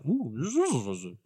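soft wavering buzz, falling away; bee buzzing. Acted. Game master as the bee who's fine, soft buzzing.

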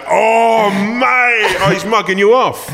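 egging on a fight, whipping up the room; jeering crowd. Retold or imitated.

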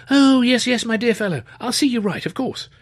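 high-pitched posh student, astonished; young Cambridge gentleman. A narrator's reading. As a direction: play the excitable undergraduate, incredulous. Narrator voices Luscombe.

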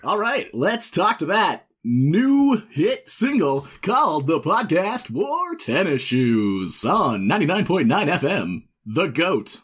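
slow, stretched radio DJ; wacky drive-time announcer drawing out words; morning DJ. Pretend. Morning DJ introducing new hit single, wacky drawl. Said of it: "say this as if you're a morning D J".

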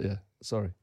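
low, soft sheepish mumble; sheepish apology. Retold or imitated. Admitting the bento box slip, softly sheepish. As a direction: quote a meek, hushed climb-down. Meek apology after being flagged up.

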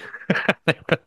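quick high burst of laughter. Real, not acted. Host laughing at his co-host's joke, high burst. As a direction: laugh at your own story. Laughing after the retold jibe.

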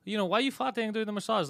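high surprised woman's voice; mock shocked masseuse. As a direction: squeak it out like a startled masseuse. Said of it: "her being like".

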